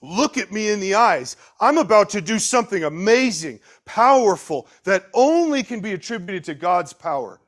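loud, high, emphatic declaration; commanding authority. Retold or imitated. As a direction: proclaim it boldly with full authority. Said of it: "spoken with that kind of authority".

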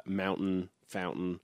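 "mountain" and "fountain" with the middle t swallowed; dropped consonants. Shown as a demonstration. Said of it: "there's just a tendency to drop, uh, consonants".

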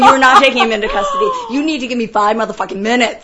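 loud, fast mock standoff; defiant demand. Producer refusing cops taking performer, defiant. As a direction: dig in and talk over the cop. Retold argument with police.

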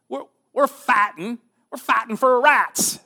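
a Southern soldier proudly declaring his cause; Southern accent. Acted. Confederate prisoner answering Union soldiers in a Southern accent. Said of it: "Sorry my, for my southern accent".

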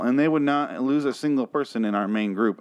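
usual speaking voice

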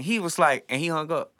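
ordinary delivery

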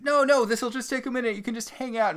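fake-sweet brush-off in a high voice; mock sugary Regina; high falsetto placating.

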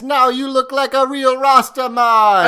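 a deliberately bad Jamaican accent, as the show did it. Podcast host mimicking the fruit vendor in a bad Jamaican accent. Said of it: "I thought you weren't gonna do the accent".

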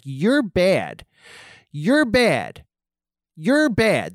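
lightly voiced finger-wagging scold; mock scolding lady with pursed lips; scolding prim lady. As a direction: nag them like a prim schoolmarm, lips pursed. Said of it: "has her lips all pursed, and she has her finger wagging".

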